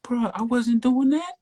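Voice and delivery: very high-pitched protest; incredulous denial. Real, not acted. Driver denying to interviewer he was speeding, incredulous.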